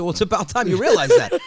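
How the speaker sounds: loud, laughing retort; laughing comeback. Retold or imitated. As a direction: throw it back with a laugh. Sarcastic reply to wife.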